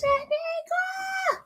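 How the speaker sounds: long, high drawn-out call of the name. Real, not acted. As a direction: sing out the name to greet her. Greeting a viewer, calling out.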